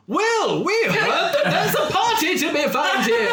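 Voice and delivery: put-on very high-pitched comic old man. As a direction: pipe up in a high elderly voice. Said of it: "two very old men wearing".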